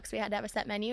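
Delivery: ordinary delivery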